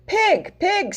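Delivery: high call to Pig. Acted. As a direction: call his name up high. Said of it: "said Hen".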